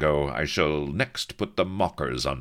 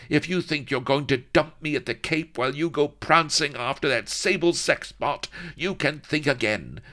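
much higher, loud jealous tirade; jealous fury; 11 semitones higher, 4 dB louder than usual. Acted. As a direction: rant like a jealous lady. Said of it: "cried Vanity hotly".

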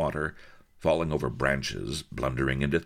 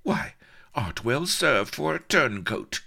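high, indignant put-down; lofty, pitched-up reproach; haughty cry; 11 semitones higher, 4 dB louder than usual. A narrator's reading. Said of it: "cried haughty Vanity".

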